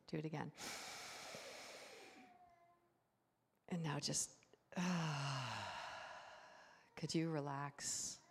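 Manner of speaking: soft, unhurried guiding voice; hushed breathing-exercise voice; calm guided breathing. Real, not acted. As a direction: gently lead them into relaxing, with long pauses. Leading congregation in breathing exercise.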